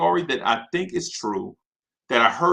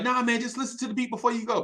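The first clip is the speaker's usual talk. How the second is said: high, fast coaxing plea; pleading producer; 9 semitones higher, 3 dB quieter, much faster than usual. Retold or imitated. Producer coaxing Big before the club, high and fast. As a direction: rush to talk him into listening.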